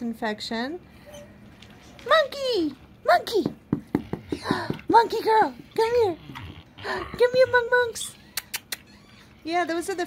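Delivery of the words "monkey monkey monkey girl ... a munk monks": high excited calling out; calling a pet. Real, not acted. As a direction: call the pet in a high sing-song.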